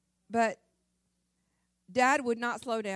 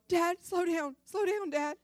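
higher, pleading big-sister whine; frightened sister in the car begging, higher voice; scared older sister; 9 semitones higher, 4 dB louder than usual. Retold or imitated. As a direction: beg dad nervously to ease off. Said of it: "she would be going".

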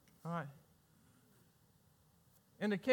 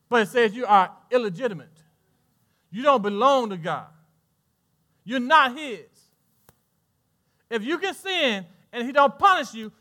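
loud preaching; 2 semitones higher, 9 dB louder than usual. Acted. Building sermon climax.

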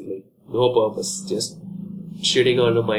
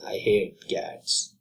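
whispered fake hatred; mock hiss; 3 semitones lower, 5 dB quieter, much slower than usual. Shown as a demonstration. Ranter whispering his fake hatred of cats. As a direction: breathe it like a dark secret. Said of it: "I don't really hate them".

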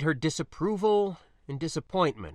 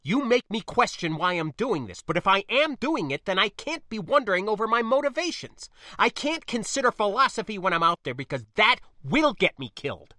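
higher, urgent outpouring; agitated argument; 8 semitones higher, 5 dB louder, faster than usual. Acted. Spacer telling niece doubts could kill, agitated. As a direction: press on through it, worked up. Spacer argues under emotional pressure.

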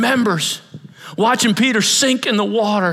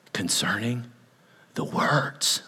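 whisper; 9 semitones lower, 8 dB quieter, slower than usual. Real, not acted.

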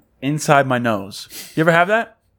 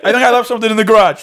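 fast, high, louder brush-off; 8 semitones higher, 5 dB louder, faster than usual. Retold or imitated. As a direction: rush out a cover-up. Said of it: "He's like".

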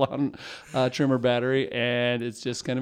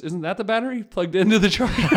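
put-on higher, laughing through the kid's question; laughing reveal; 8 semitones higher, 6 dB louder than usual. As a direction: let the laugh break in as the truth comes out. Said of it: "He goes".

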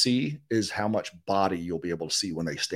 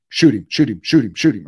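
loud urgent repeated shoot him; urgent radio call; 3 semitones higher, 11 dB louder than usual. Retold or imitated. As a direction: hiss it fast over the radio. Guide urging over radio.

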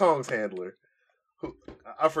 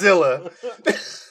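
slow, high shouted commentator call; Jim Ross impression; 7 semitones higher, 7 dB louder, much slower than usual. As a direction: stretch it out like ringside play-by-play. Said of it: "doing her best, best Jim Ross".

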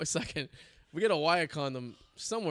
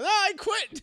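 much higher and a touch louder; a squeaky, cheeky prank-caller hanging up; mock prank-call quit; 5 dB louder, slower than usual. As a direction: toss off the punchline in a squeaky voice.

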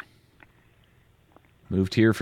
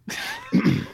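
high breathy laugh bursting out; cracking up at the prank; burst of laughter. Real, not acted. Laughing at the piss-on-you offer.

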